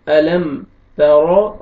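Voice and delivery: तरो with a thick, heavy ra; तरो, ra pronounced full-mouthed. Shown as a demonstration. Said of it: "रा को आपने मोटा करके पढ़ना है".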